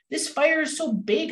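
high, lightly voiced wolf wail; howling wolf. A narrator's reading. Wolf faces the huge forest fire, mild storybook howl. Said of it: "howled Wolf".

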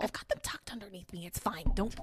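hushed whisper. Real, not acted. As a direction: keep it to a whisper. Whispering reassurance.

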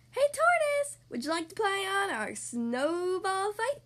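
high, excited squirrel voice; eager squirrel. A narrator's reading. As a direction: call out high and keen, inviting a friend. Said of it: "asked Squirrel".

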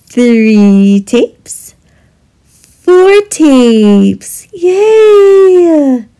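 building up to a loud high cheer; excited cheer. Acted. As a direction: cheer the toddler on, rising to a yay. Cheering toddler during diaper change.

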